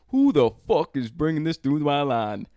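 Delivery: loud, high-pitched indignant demand; mock-angry shout at a line of travelers; retold irate agent. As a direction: play an outraged security guard calling out. Retold angry TSA agent confronts him.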